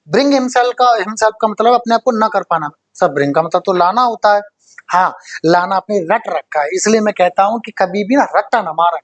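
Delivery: normal talking voice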